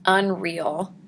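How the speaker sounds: ordinary delivery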